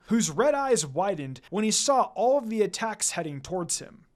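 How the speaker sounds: plain reading voice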